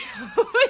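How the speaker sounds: big squealing laugh; real high laugh; loud high laugh. Real, not acted. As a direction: burst out laughing. Burst of laughter.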